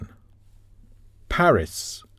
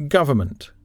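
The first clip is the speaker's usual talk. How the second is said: Government, with GOV stressed; stress on first syllable. Shown as a demonstration. Teacher showing learners word stress, Government stressed on the first syllable. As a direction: stress the opening "Gov" of "Government", the rest unstressed. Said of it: "has the stress on the first syllable".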